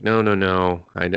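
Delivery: usual speaking voice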